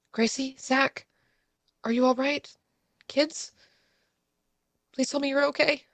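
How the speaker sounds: scared mother calling under the table; panicked calling out. Acted. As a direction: call for the children in rising panic. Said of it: "little bit of panic on my face".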